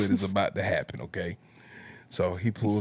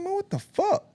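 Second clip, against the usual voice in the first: sudden angry outburst, much higher in pitch; got mad. Retold or imitated. Telling off the scammer who tried the same line, furious disbelief. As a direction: blow up in disbelief, pitch jumping high. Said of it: "that's when I got mad".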